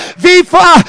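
usual speaking voice

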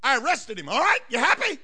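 a king yelling back at his wife; defensive shout. Acted. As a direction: shout her down, exasperated. Herod snaps back at wife.

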